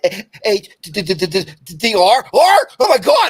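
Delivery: a starstruck fan tripping over the letters; excited fan stammer. Retold or imitated. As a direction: stammer out the name, then squeal it. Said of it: "he's like".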